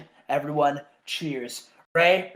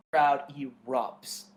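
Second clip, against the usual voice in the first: ordinary delivery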